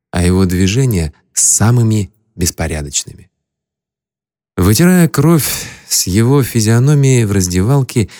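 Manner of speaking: plain reading voice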